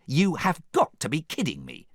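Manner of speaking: high, incredulous outburst; exasperated cry. A narrator's reading. Fiona cannot believe the princesses, crying out incredulous. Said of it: "cried Fiona".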